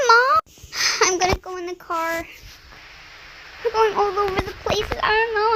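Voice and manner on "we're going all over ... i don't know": usual speaking voice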